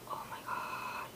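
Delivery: breathy unvoiced oh my god; exasperated whisper. A fed-up cousin whispering about the endless Heelys. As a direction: whisper it in disbelief. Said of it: "I was like".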